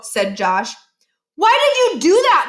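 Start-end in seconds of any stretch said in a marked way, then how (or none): 1.3-2.5 loud high outcry, faking outrage; cried out. Acted. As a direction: yell it out high and loud like the victim. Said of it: "Miles cried out".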